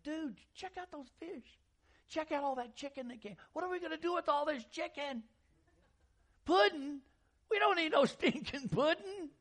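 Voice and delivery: high, loud dude voice, hammed-up; surfer dude disciples. Preacher voicing disciples as surfer dudes, high and hammed-up. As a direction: goof it up like a stoner.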